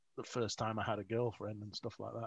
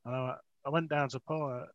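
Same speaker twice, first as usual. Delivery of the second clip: normal talking voice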